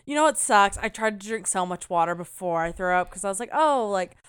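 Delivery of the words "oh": ordinary delivery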